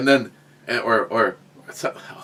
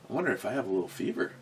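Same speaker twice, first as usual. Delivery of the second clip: fast, soft inner worry; muttered to self; 3 semitones lower, 12 dB quieter, much faster than usual. Retold or imitated. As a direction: mutter it quickly under your breath. Worried thought to himself.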